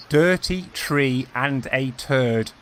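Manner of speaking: Irish "Dirty tree and a turd" spelled out very slowly; slow teaching take of the Irish phrase; slow Irish accent demo. Shown as a demonstration. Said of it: "how to do that in an Irish accent".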